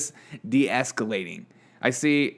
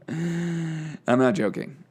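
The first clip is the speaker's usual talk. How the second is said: usual speaking voice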